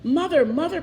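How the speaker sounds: light storybook call for mother. A narrator's reading. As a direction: call across the castle. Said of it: "called out Radius".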